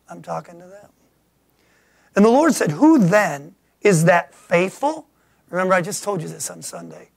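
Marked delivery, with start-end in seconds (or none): 2.1-5.1 scripture reading. Acted. Reading scripture again, slower.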